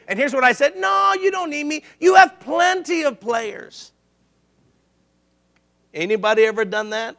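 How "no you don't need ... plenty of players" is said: high-pitched, drawn-out refusal; put-on protest, pitched up and stretched at the end; mock brush-off. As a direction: act out refusing your pleading teammates. Acts out his angry refusal to teammates.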